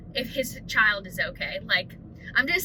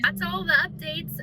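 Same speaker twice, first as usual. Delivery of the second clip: ordinary talk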